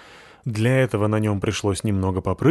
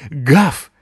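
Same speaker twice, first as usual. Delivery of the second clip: loud, higher barked word; 7 semitones higher, 7 dB louder than usual. A narrator's reading. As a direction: bark it out. Dog barks his greeting.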